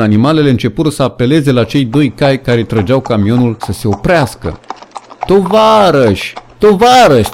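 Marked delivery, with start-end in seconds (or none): 5.3-7.3 very high, loud desperate call; pleading scream. A narrator's reading. Animals screaming to the cart horses, desperate pleading. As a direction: cry out to the horses to stop. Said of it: "țipară ele".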